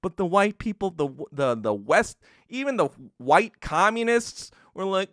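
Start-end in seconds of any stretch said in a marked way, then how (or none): none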